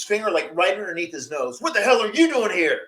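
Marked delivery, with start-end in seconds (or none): 1.6-2.8 high mock angry yell; imitating angry dad. [name] retelling dad's yell, mock angry imitation. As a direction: replay the dad's outburst for laughs.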